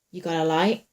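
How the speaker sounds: ordinary delivery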